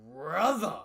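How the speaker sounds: loud, drawn-out outburst of disbelief; stunned exclamation. Real, not acted. Reader stunned by a character's lookalike face, loud exclamation. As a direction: belt it out in disbelief.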